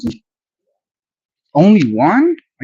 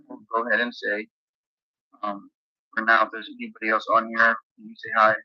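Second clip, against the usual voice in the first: much softer take of the request; quiet repeat; 11 dB quieter than usual. Shown as a demonstration. Streamer quietly asking viewers to say hi. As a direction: say it again under your breath. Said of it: "repeats request much softer".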